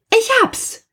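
very high, excited eureka cry; sudden realisation. A narrator's reading. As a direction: burst out with a sudden idea. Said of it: "sagte sie plötzlich".

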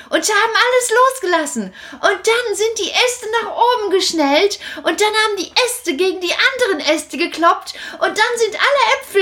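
put-on bright high puppet boy telling his trick; Kasperl, excited. Kasperl boasting to Großmutter, excited and high. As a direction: rattle it off, thrilled. Kasperl tells apple story.

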